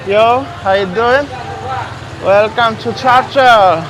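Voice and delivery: loud, cheery hail to the street sellers; calling out. Real, not acted. Greeting the boys selling on the street, loud and cheery. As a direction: call out a welcome across the busy street.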